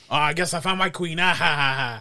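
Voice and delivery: gloat, then fake a loud ha-ha; gloating brother. Friend retelling brother taking the queen, mock gloating. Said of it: "just see him just take".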